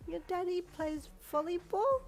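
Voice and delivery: put-on high, slow, wondering child; little girl voice. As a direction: ask in awe like a small child. Child asking in awe.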